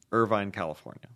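usual speaking voice

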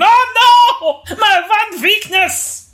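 high, slow witch wail with a laugh; melting witch. Retold or imitated. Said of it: "You make him sound like a wicked witch".

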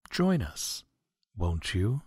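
ordinary talk, nothing marked

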